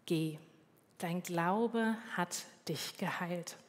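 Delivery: slow and soft, spaced words; solemn soft pronouncement. Retold or imitated. Jesus sends the blind beggar off, slow soft retold words. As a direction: say it quietly and slowly.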